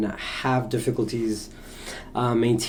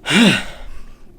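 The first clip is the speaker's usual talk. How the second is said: heavy sigh; 7 semitones higher, 7 dB louder than usual. Real, not acted. Catching breath after apology.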